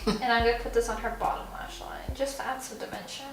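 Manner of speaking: soft close-up whisper. Real, not acted. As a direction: hush while working near the eye.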